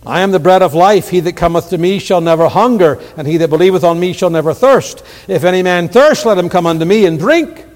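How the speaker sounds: loud, high, ringing scripture proclamation; full-force preaching. Real, not acted. Preacher crying out Jesus' words to the congregation, full force. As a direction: preach it out to the back pews.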